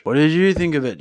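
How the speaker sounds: ordinary talk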